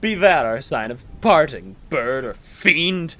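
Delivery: high, loud shriek. A narrator's reading. Narrator shrieking at the raven. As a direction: scream the question at the bird. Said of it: "I shrieked".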